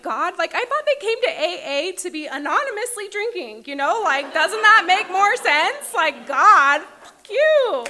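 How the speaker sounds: angry outburst. Retold or imitated. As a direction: rant at God, building to a yelled curse. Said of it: "I was very angry".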